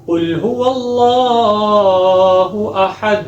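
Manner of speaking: slow, high chanted recitation; measured tajweed chant; Quran recitation. Shown as a demonstration. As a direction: draw each word out melodically. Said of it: "سب سے پہلی والی آیت شروع کرتے ہیں".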